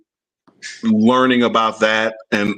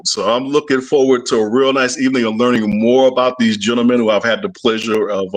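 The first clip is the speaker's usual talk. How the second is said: ordinary talk, nothing marked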